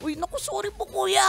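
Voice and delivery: apologetic tone. Shown as a demonstration. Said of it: "mababa agad".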